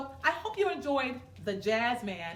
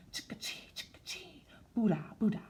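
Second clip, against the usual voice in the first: whispered scat; 4 dB quieter than usual. Acted. Drum scat in book.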